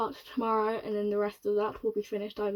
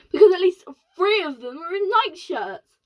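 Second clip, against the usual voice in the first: excited insistence; 8 semitones higher, 10 dB louder than usual. Acted. Astronaut insisting, near tears.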